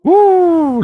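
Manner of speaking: loud, very high woo; hype woo. Real, not acted. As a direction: whoop to pump up the crowd. Hyping the main event.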